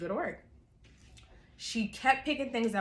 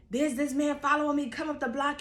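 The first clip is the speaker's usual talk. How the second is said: loud phone call; 5 semitones higher than usual. Retold or imitated. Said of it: "I'm loud as ever".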